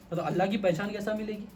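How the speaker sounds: usual speaking voice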